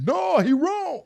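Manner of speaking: high, drawn-out, loud mock protest; imagined loud objection. Imagining how Jag would object, loud mock protest. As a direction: cry out an imagined rebuttal, stretched and high. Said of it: "would have probably been like".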